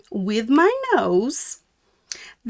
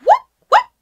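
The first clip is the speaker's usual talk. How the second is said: very high, loud squeaky alarm cry; high yelping warning call; meerkat alarm call; 9 dB louder than usual. Pretend. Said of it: "I say".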